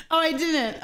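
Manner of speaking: usual speaking voice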